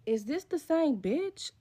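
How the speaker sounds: slow, drawn-out disbelieving question; stunned and incredulous; shocked disbelief. Retold or imitated. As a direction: let it dawn on you slowly. Realizing it's the same girl.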